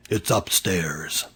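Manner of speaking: low, soft and slow; understated, drop right down; quiet, deadpan reply; hushed answer. A narrator's reading. Child's deadpan answer to police.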